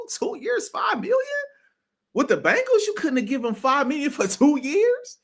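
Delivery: can't believe how cheap it was; incredulous. Real, not acted. Disbelief at cheap contract.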